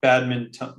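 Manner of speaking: BADMINTON sounded fully, bad-min-ton; N pronounced. Shown as a demonstration. Co-host corrects host that there's an N, sounding it out. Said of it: "No, there is an N".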